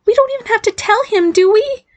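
high, squeaky plea at normal volume; normal volume after shrieking. A narrator's reading. Barry begs mother not to tell Dad, high-pitched plea. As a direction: plead in a high girlish voice, not shouting. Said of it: "then lowered his voice to a normal volume".

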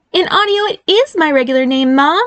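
high-pitched incredulous protest; disbelieving. Acted. Barry corrects mother about his name, disbelieving. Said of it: "pointed out in disbelief".

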